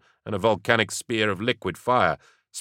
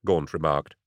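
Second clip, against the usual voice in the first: ordinary talk, nothing marked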